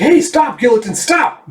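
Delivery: high, loud yell at a partner; shouting to stop. Acted. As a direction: bark him off the van. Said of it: "He's like".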